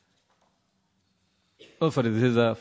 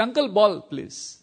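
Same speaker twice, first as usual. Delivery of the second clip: imitated boy's request; 6 semitones higher, slower than usual. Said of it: "We would say".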